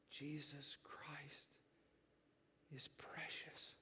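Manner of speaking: faint breathy whisper, slow; barely a whisper, like a frail old man. Retold or imitated. Frail old preacher whispering to his church. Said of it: "he said in a whisper".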